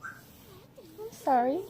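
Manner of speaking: soft, slow, high cooing sorry; tender sympathy. Real, not acted. Mom consoling child who got in trouble, tender sympathy. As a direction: draw it out gently and softly.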